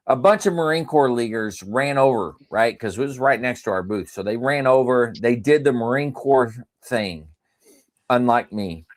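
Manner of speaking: ordinary delivery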